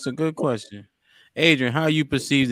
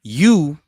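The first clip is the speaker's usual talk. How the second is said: "you" much louder, in capital letters; emphatic YOU; 3 semitones higher, 10 dB louder than usual. Real, not acted. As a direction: hit "you" hard and loud. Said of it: "shoulda capitalized that bitch".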